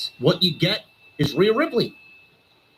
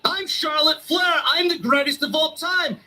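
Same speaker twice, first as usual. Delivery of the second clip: mock Charlotte; 6 semitones higher than usual. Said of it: "same gimmick over and over".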